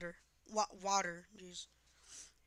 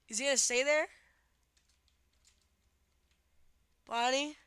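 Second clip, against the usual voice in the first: panicking gamer; 3 semitones higher, 6 dB louder than usual. Real, not acted. Enemy waiting nearby.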